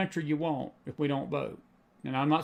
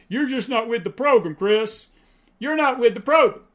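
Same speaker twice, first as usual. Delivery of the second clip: loud, high mocking taunt; mocking critic; 7 semitones higher, 8 dB louder than usual. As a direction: throw the critic's jab back loudly and higher. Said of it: "I'm told that a lot".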